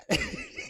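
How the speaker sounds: sudden high-pitched laugh; high burst of laughter. Real, not acted. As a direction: break into a squealing laugh. Bursts out laughing mid-rant.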